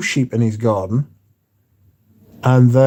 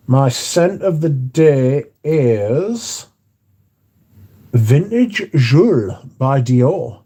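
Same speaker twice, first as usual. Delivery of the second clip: drawn-out, slow announcement of the scent; slow dramatic reveal; 3 semitones higher, much slower than usual. Acted. Host answering the chat, slowly revealing his scent of the day. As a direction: build suspense before naming it.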